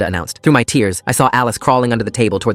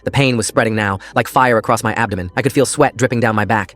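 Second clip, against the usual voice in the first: ordinary delivery, nothing marked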